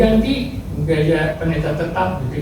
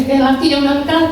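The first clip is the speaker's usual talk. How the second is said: higher-pitched surprised aside; mildly startled, pitched up; taken aback; 6 semitones higher than usual. Retold or imitated. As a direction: say it as a startled discovery. Startled on hearing a man answer.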